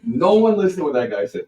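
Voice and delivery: high, loud retold shout; mad override. Singer overruling the sound guy to everyone, mad shout. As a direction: shout it over the room in retold anger. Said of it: "see you get so mad".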